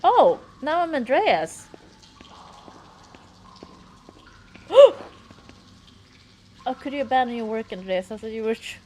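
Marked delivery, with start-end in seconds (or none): 0.0-0.4 sudden loud high Oh; startled. Real, not acted. The game switches to Andreas, startled exclamation. 0.6-1.7 loud, high, slow exclamation; excited. Real, not acted. Realizing they now play Andreas, excited shout. 1.7-6.0 sharp high breathy gasp; startled gasp. Real, not acted. Frightened by something on screen, loud gasp. Jump scare in game.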